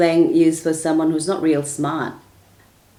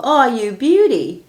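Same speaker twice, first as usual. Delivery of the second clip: loud, slow, higher cheer of delight; cheering call; 6 semitones higher, 4 dB louder, slower than usual. Retold or imitated. A fan calls out across the field after a goal, loud slow cheer. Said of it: "then you would hear someone call".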